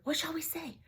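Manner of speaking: high unvoiced whisper; panicked whisper. Sisters whispering desperately to each other. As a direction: whisper fast to your sister. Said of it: "they whispered".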